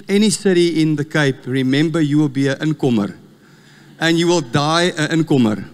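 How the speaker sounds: ordinary talk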